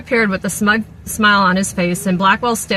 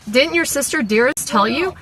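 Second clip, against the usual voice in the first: innocent; 6 semitones higher than usual. A narrator's reading. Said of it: "Sinclair asked innocently".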